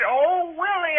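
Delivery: mock his sister's calling; imitated holler. Brother complains to mother, mimicking sister's holler. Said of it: "She hollered".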